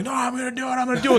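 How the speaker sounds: high, thin mock scream, held back in volume; strained, high-pitched mock yell; retold scream. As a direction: imitate desperate screaming without the loudness. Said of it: "screaming and like".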